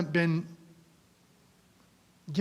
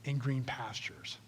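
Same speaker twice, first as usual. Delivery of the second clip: hushed recitation; 4 semitones lower, 11 dB quieter than usual. Acted. Sermon opening recitation, hushed.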